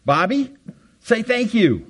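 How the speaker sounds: high, singsong parental prompt; parent's prompt. Retold or imitated. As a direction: nudge the child like a parent. Parent prompting child to say thanks.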